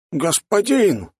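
very high, drawn-out exclamation; astonished mage. A narrator's reading. Old mage startled by lord's order, high astonishment. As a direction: stretch the address out in shock. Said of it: "округлились глаза Астериона".

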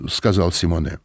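ordinary delivery, nothing marked

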